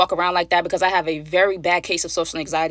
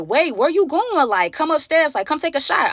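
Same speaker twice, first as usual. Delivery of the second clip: put-on pleading girl; 7 semitones higher than usual. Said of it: "The girl like".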